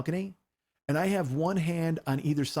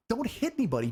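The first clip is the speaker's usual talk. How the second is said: retold plea, pitched high; urgent plea; 7 semitones higher, faster than usual. Girlfriend warning him off a fight, urgent plea. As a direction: urge them to hold back, higher voice.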